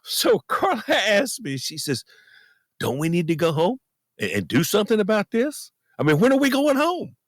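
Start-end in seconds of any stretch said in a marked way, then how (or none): 2.8-7.2 retold questions climbing steadily higher; imitating wife's questioning. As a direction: lightly voice your wife, pitch rising with each question. Voicing wife's worried questions.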